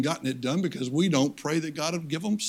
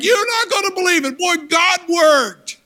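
much higher and louder, slow and amazed; excited report; 13 semitones higher, 7 dB louder than usual. Retold or imitated. Missionary returning to tell the church, excited. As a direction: burst out with the good news. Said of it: "he was like".

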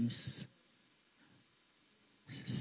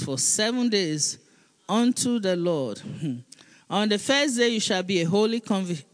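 raised, declaiming Bible reading; scripture reading; 3 semitones higher than usual. Acted. Pastor reading Leviticus aloud to the congregation, declaiming. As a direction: proclaim the verse to the church.

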